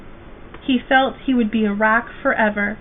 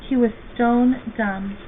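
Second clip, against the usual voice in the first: ordinary delivery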